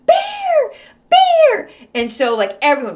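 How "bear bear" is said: high, loud panicked scream; hammed-up terrified yell; fake bear scream. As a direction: shriek bear like it's real. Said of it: "I screamed that I saw a bear".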